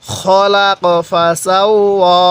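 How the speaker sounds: খলাক with a short খ, not খা, slow; correct kha. Shown as a demonstration. Teacher correcting খলাক for students, slow. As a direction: recite খলাক with short খ vowel. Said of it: "খ জবর খা পড়ব না".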